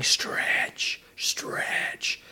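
urgent backstage whisper. Retold or imitated. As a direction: hiss it from the wings. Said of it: "the stage manager's going".

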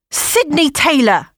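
high, slow scolding snap of the name; snapping. Acted. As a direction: snap her full name. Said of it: "she snapped".

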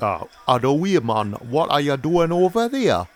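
loud, high-pitched Geordie shout; hammed-up Newcastle accent. Shown as a demonstration. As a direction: put on a broad Geordie accent, calling out. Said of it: "do you, Newcastle?".

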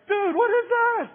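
shocked disbelief. Retold or imitated. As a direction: squeal it in total disbelief. Kid shocked by bully's move.

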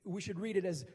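ordinary delivery

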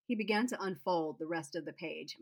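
ordinary delivery

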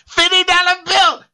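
high-pitched, put-on catchphrase voice; Data. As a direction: squeal it out like a movie-character impression. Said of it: "you sounded very, uh, Tyler Perry".

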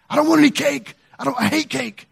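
high, put-on irate neighbor voice; mock angry refusal. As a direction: imitate a cranky neighbor rejecting an offer. Acting out neighbor refusing cake.

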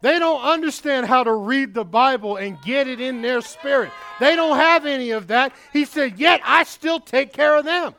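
loud, high, driving sermon delivery; full-force preaching. Acted. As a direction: preach it hard to the congregation.

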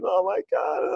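hammed-up tearful gush; mock weepy winner.

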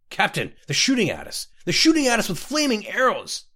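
rising from alarm to a high, loud cry; frantic warning, climbing in pitch; panicked alarm. A narrator's reading. Panicked warning under arrow attack.

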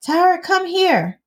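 high, slow call across the house. Retold or imitated. Mom calling daughter to the room, slow high call. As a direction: summon her from the other room.

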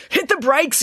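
high urgent yell. Acted. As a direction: shout the order at the driver. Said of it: "yelled Bruce".

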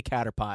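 "Caterpie" with the last syllable like the dessert pie; mistaken pronunciation. Shown as a demonstration. Host admitting his habitual slip, "Caterpie" ending in a PIE sound. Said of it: "I keep wanting to say".

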